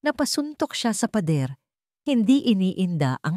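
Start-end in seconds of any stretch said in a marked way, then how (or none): none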